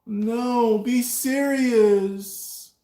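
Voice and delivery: high, very slow scolding; girlfriend voice. Retold or imitated. Girlfriend's retort.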